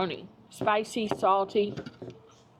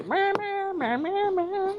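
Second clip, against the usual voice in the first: high silly bleating; 13 semitones higher, slower than usual. Pretend. Playful bleat after saucy joke.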